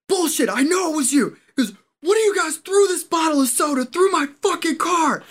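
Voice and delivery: high, loud furious yelling; angry accusation. Retold or imitated. Car owner accusing the lined-up marines, shouting angrily. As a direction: accuse them at full volume.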